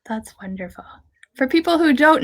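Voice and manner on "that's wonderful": a soft whisper of approval. Real, not acted. Host softly approving the guest's lifelong love of rabbits, whispered. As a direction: breathe it out, barely voiced.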